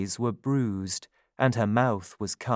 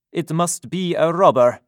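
loud, blunt policeman's voice; london policeman; 6 semitones higher, 8 dB louder than usual. A narrator's reading. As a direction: brush it off like a cop. Policeman dismisses case.

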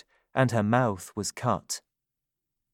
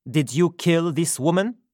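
louder, blunt policeman's voice; london policeman; 6 semitones higher, 4 dB louder than usual. A narrator's reading. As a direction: question him like a hard cop. Policeman questions Jonathan.

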